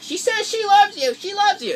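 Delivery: high, loud passing the message on; relaying stranger. Retold or imitated. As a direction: shout it down the line to him. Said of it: "the guy's like".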